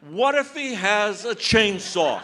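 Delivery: mock repeat. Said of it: "She said".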